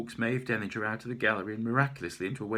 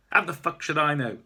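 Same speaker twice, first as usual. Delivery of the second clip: loud, higher furious outburst; enraged artist snapping back; spitting anger; 8 semitones higher, 10 dB louder than usual. Acted. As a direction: spit the words out at him. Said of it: "She turns and spits out".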